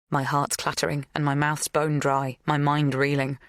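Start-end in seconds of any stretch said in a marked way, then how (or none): none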